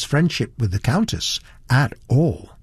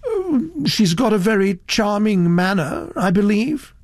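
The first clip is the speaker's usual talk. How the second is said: put-on higher, hesitant older gentleman; cautious; 9 semitones higher than usual. A narrator's reading. Satterthwaite answering Elizabeth about the Countess, cautious. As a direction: venture it tactfully, with hesitation. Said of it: "said Mister Satterthwaite cautiously".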